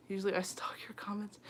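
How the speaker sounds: whispered confession, half-teasing; playful whispered aside; joking whisper. Pretend. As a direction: slip it in under your breath as a joke. Joking aside while reading comments.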